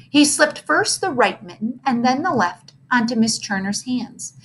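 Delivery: ordinary delivery, nothing marked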